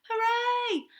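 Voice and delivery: loud, high cheer; excited cheer. Acted. Cousins cheer for the story, joyful hooray. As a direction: whoop with delight. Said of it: "they cried".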